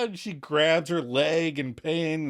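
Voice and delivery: usual speaking voice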